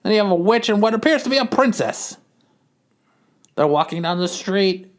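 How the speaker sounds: ordinary talk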